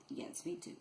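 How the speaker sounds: ordinary talk, nothing marked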